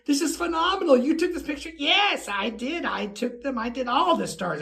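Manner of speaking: high, thrilled back-and-forth; retold excitement. Fan and photographer marvel over her picture, high retold excitement.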